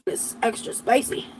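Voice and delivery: eyes watering from onions; teary, near breathless; teary from chopping onions. Real, not acted. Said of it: "My eyes don't normally water".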